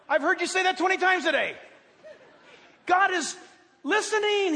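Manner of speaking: high, loud fervent sermon voice; preaching at full force. Acted. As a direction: drive the point home to the congregation.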